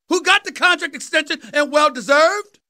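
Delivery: ordinary talk, nothing marked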